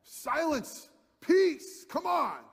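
put-on high, loud shout at rowdy kids; exasperated scream. Parent screams at wild kids for silence, loud. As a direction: yell for silence, fed up. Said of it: "You wanna just scream at 'em".

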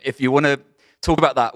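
ordinary talk, nothing marked

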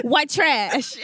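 loud, high, laughing jab. Real, not acted. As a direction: blurt it out mid-laugh.

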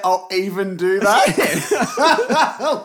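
very slow, laughing, high drawl; mocking a grudging offer of tea; mock reluctant offer. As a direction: drag it out like it's a huge favour. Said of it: "did you say".

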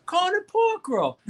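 high-pitched mock indignation; outraged locals. New Jersey locals reacting to pork roll, high mock outrage. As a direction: squeal it in comic disbelief at the outsider. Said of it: "They, they look at each other".

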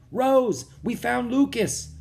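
high, loud excited call; excited cry. Acted. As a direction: burst out with the good news. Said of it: "Jack cried".

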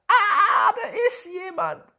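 high, loud frightened shout; boy finding someone hidden in the mill, screaming in fright. A narrator's reading. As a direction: scream it out in a panic. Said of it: "Gleich darauf schreit er".

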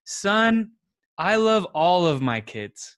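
a dad answering a bit formally and flat; detached dad reply. Retold or imitated. Dad answering his crying son with a loud flat blanket statement. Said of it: "and then if I responded".

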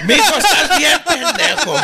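high, loud teasing scold; teasing the son like a mom would; mock parent scolding. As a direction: scold him like a laughing parent.

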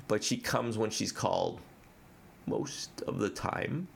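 normal talking voice

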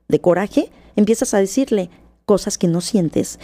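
ordinary talk, nothing marked

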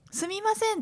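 high, apologetic すみません; typical sumimasen. Retold or imitated. Nurse calling to a patient, high apologetic すみません. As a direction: chirp すみません up high and timid. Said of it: "って私も言ってたんですよ".

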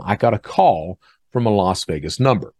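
ordinary delivery

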